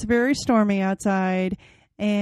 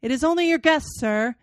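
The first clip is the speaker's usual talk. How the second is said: called out; 4 semitones higher than usual. Acted. Guest calling to a frightened Heathcliff, raised voice. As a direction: call out to calm the startled host. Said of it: "I called out".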